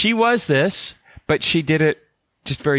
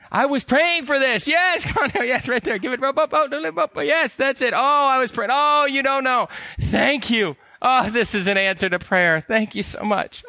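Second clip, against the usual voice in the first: high-pitched, hammed-up gushing gratitude; mock-emotional outpouring, high and effusive; very emotional relief; 11 semitones higher than usual. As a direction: gush with overblown relief at being caught. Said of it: "they'll be very emotional".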